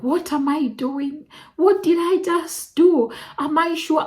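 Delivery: high, anxious self-questioning; reliving the moment of panic, pitched well up; panicked thoughts. Retold or imitated. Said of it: "and I'm like".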